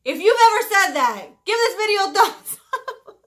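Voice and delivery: laughing. Real, not acted. Laughing at herself to viewers.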